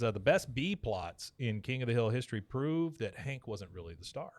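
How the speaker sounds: ordinary talk, nothing marked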